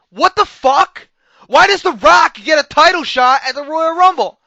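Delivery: loud, high-pitched exasperation; outraged rant. Real, not acted. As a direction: burst out in disbelief at the decision. Outraged rant at booking decision.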